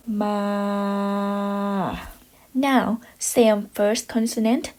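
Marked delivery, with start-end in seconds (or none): 0.1-2.0 "ma" held level on a middle pitch; Thai middle tone. Shown as a demonstration. Teacher showing students the middle tone, level. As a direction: keep "ma" flat and steady. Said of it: "middle tone".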